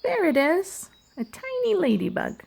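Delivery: normal talking voice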